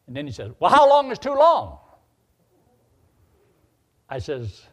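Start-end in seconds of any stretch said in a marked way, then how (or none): 0.6-1.8 high, loud retort; loud challenge. Retold or imitated. Long-haired kid challenging the preacher's answer, loud retort. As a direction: fire back a challenging question.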